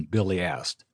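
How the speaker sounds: usual speaking voice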